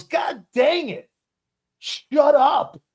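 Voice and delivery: high, loud, drawn-out mock yell; imitating a fed-up shout; exasperated outburst. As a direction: blow up at someone who won't stop.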